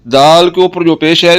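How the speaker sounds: normal talking voice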